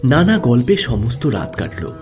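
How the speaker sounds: ordinary delivery, nothing marked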